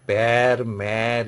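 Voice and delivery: "pear, mare" with open, spread-lipped eh; flattened vowel. Shown as a demonstration. French teacher showing students the lip shape, flattened vowel. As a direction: widen the lips on both vowels of "pear, mare". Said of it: "your lips are expanding".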